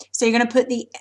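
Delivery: normal talking voice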